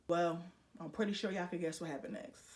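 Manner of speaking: ordinary talk, nothing marked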